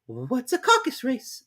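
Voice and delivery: put-on high, slow girl voice; curious Alice. Alice asking the Dodo, high and slow. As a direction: ask it curiously. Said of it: "said Alice".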